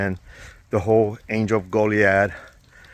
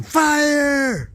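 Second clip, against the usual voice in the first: yelling. Retold or imitated. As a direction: shout it like a kid playing. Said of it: "standing here and yelling".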